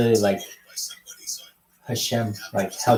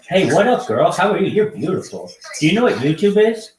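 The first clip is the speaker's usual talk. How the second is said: higher, louder, bouncy stream-host voice; hyped host greeting; 7 semitones higher, 4 dB louder than usual. Acted. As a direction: greet strangers like a pumped-up show host. Hyped greeting to video-chat strangers.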